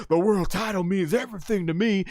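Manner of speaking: imitating Stone Cold. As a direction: declare it holding up the belt. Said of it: "And he holds up".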